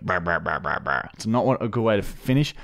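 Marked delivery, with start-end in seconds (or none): 0.1-1.1 fart noise. Retold or imitated. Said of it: "You go".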